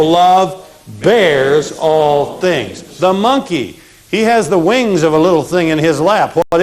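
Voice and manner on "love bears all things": slow recitation. Shown as a demonstration. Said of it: "review chant".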